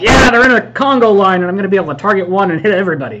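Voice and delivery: loud, high cheer, then rushing on; excited cheer. Retold or imitated. Player recalling his glee at a Congo line, excited cheer. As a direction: burst out cheering and race through the plan. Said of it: "So I was pretty excited".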